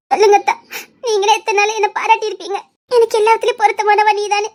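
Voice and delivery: put-on sobbing appeal to his old praise; tearful pleading. Woman reminds her lover she would have been a good wife, crying. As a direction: plead through tears. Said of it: "சரி, அழாத".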